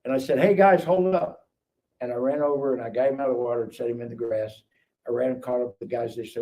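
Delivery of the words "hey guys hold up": drawn-out, higher hail; retold calling out. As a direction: call lightly to friends ahead. Calling to friends ahead on path.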